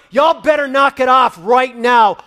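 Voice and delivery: loud, high threatening shout; angry parent shout. Retold or imitated. Fed-up parent threatening the fighting kids, angry shout. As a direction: bark the warning down the stairs. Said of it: "You double down".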